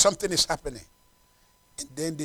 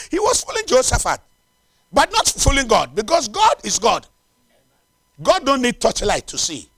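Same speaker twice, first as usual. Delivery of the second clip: high-pitched, insistent preacher; impassioned preaching; 10 semitones higher, 7 dB louder than usual. Real, not acted. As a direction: press the point on the congregation. Preaches with force.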